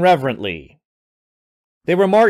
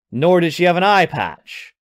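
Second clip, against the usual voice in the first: ordinary delivery, nothing marked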